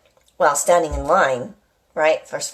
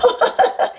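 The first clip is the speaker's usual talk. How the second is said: laugh. Real, not acted. Laughing at a driver.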